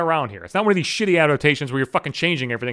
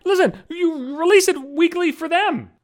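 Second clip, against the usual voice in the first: exasperated rant; 10 semitones higher, slower than usual. Real, not acted. Ranting about weekly releases.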